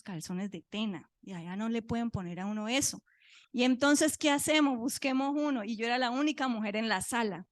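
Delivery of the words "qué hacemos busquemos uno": ordinary talk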